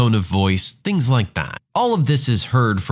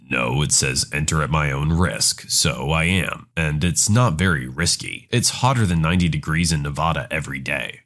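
low, smug American tourist voice; arrogant American tourist; 9 semitones lower than usual. Retold or imitated. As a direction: wave off the lifeguard, lower. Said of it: "the American responds".

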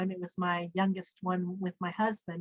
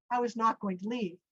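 ordinary talk, nothing marked

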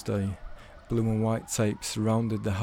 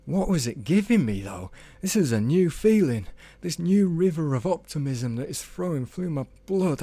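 awestruck as if in love; Tony marvelling at his new followers, rapt; rapturous wonder; 6 semitones higher than usual. A narrator's reading. Tony's rapturous inner monologue.

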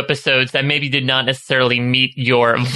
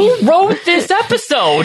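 incredulous; slower than usual. Acted. Mock incredulous question.

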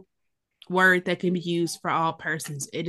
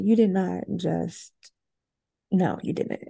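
disapproving; 4 dB quieter, slower than usual. Retold or imitated. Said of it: "looking at me like I'm crazy".

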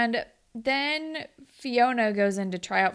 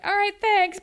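mock dismissive thanks; 10 semitones higher, 4 dB louder than usual. Judges dismissing bad audition.

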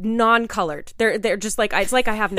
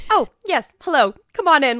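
flustered bright welcome; 5 semitones higher, 5 dB louder than usual. Retold or imitated. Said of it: "So I go".

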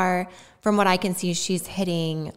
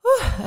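higher, louder puff of breath; steadying whew; 8 semitones higher, 5 dB louder than usual. Real, not acted. Mother getting back to her tribute, relieved exhale. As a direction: blow the emotion out before carrying on. Composing herself before going on.

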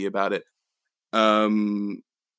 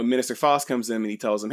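normal talking voice

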